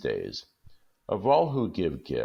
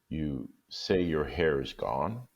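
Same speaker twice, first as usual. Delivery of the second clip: dazed question; slower than usual. Acted. Jim dazed.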